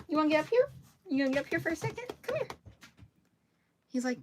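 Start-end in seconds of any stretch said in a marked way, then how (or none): 0.1-2.5 coaxing pet talk. Real, not acted. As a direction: invite the dog up gently. Coaxing dog onto seat.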